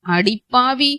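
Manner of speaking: plain reading voice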